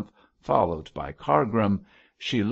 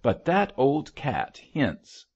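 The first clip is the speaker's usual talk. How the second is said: slower, higher-pitched irritable retort; snapping; 6 semitones higher, slower than usual. A narrator's reading. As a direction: press the point with some irritation. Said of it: "snapped her father".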